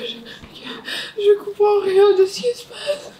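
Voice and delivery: tearful and panicked; crying. Real, not acted. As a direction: sob it out, confused and scared. Post-surgery panic, crying heard.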